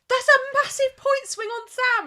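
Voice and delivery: high and loud gloating; wife imitates husband gloating about the swing, high and loud; gleeful gloat. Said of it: "And Lee was like".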